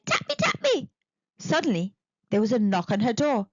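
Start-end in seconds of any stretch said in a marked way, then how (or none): 0.1-0.9 high squeaky little creature voice. Acted. Pink thing begging Eddie, high squeaky creature voice. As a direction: chirp it like a tiny gremlin. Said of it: "all saying".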